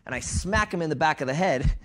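normal talking voice

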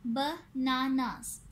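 "Bananas" slowly in three beats; "bananas" broken up to count syllables. Shown as a demonstration. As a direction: say "bananas" as ब, ना, नास. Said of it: "इनके three syllables हैं".

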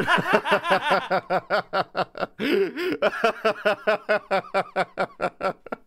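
long helpless real laugh; loud sustained laughter. Real, not acted. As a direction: crack up fully. Laughing at the joke.

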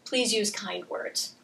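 gentle request. Shown as a demonstration. Parent showing a kind request to a child, soft and slow. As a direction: model calm kindness.

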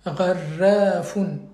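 Gharrafun drawn out slowly, the r strongly rolled. Shown as a demonstration. Teacher corrects a student's reading, slow model with a rolled r. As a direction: stretch Gharrafun out and trill the r. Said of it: "roulé".

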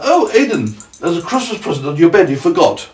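high, mock-surprised announcement; pretend excitement. Parent demonstrating a trick on child, high mock excitement. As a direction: sell the fib brightly and high.

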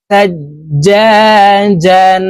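"সাজ্জাজান" chanted with long "জা" and closing n; tanween reading. Shown as a demonstration. Teacher models tanween for learners, "সাজ্জাজান" slowly intoned with final n. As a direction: hold the middle of "সাজ্জাজান", end on a joined n. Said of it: "তানবিনওয়ালা হরফ নুন যুক্তভাবে তাড়াতাড়ি করিয়া পড়িতে হয়".

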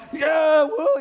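high, loud fan's cheer; mock stadium whoop. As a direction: cheer like a fan in the stands. Fans yelling at game.

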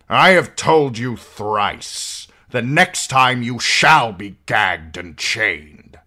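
a hint of a stern father laying down the law; stern lord. A narrator's reading. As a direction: lord warning his son at trial. Lord Tywin warns Tyrion.

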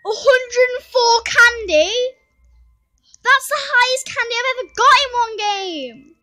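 shrieking jackpot winner. Real, not acted. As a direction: shout the record in disbelief. Record candy score.

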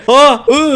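high loud gasps; panicked gasps. Real, not acted. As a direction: gasp in panic. Trap closes on him.

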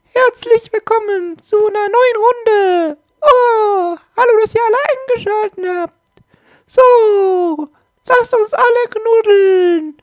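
high-pitched cartoon bear voice; Pedro Bär voice. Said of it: "Na, dankeschön, Pedro Bär".

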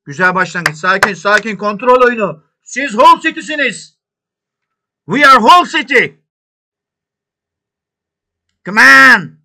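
loud, high praise shouted at the screen; coaching from the touchline, shouting; cheering. Real, not acted. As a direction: egg the players on. Cheering players.

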